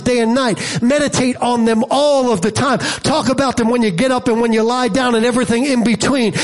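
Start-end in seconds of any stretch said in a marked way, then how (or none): none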